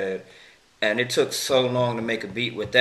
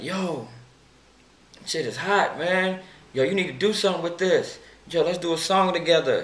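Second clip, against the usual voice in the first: higher, hyped-up friend raving about the track; imitating excited friend; 8 semitones higher than usual. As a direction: pitch it up and gush like a buddy who loves the song.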